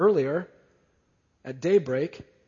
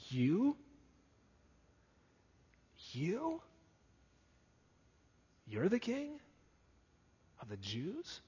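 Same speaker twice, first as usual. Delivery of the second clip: astonished disbelief; 4 semitones higher, 9 dB quieter, slower than usual. Acted. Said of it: "Pilate is astonished".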